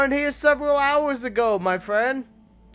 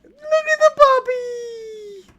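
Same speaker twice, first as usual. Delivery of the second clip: slower, high cooing puppy talk; talking to a dog; 13 semitones higher, 3 dB louder, slower than usual. Real, not acted. Cooing over wolf pup.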